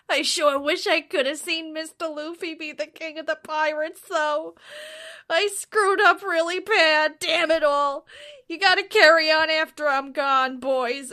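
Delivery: hammed-up tearful last words; tearfully. Bardo saying goodbye to his crew, mock tearful and loud. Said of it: "Bardo tearfully admits".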